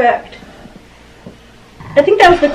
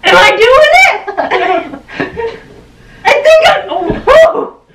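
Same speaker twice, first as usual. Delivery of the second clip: high, laughing squeals; excited wobbling; 9 semitones higher than usual. Real, not acted. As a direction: squeal through laughter while losing balance.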